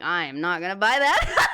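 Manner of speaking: amused, half-shocked no; laughing refusal. Retold or imitated. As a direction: laugh off the price tag. Balks at the price.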